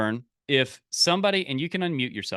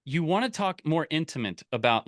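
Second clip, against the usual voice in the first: usual speaking voice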